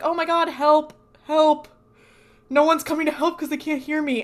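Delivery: high, loud retold cry for help; panicked call for help. Dean as ghost calls out as a woman dies, mock panicked shouting. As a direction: act out calling desperately to people who can't hear.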